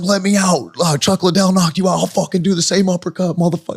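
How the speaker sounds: put-on high, loud drunk raving; drunken rage. Drunk kid raging at Randleman from the back seat, high and loud. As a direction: rave at him, fired up and drunk. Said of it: "I'm going crazy on this".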